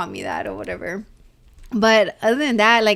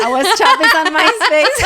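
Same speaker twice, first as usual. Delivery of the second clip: higher, louder, laughing as it comes out; laughing admission; 9 semitones higher, 8 dB louder than usual. Real, not acted. Woman owning up to her old MySpace nickname, laughing. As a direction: own up through a big laugh.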